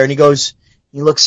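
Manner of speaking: ordinary talk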